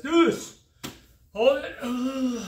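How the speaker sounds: tight effortful cue under strain. Real, not acted. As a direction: push the words out while holding a plank. Strained cue while holding plank.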